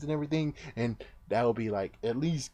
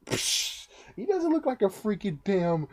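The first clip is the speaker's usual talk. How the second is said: scoffing; 10 semitones higher than usual. Retold or imitated. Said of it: "I was like".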